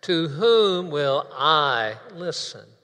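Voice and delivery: slow, loud, every word weighted; repeated with emphasis. Shown as a demonstration. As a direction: draw it out and hit each word. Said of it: "Now, let's do that".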